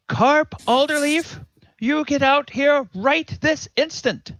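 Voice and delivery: a mother calling her son out to face the music; mom voice. Acted. Farm woman calling her son out of the house in stern mom voice. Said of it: "in mom voice says".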